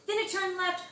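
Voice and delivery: ordinary delivery, nothing marked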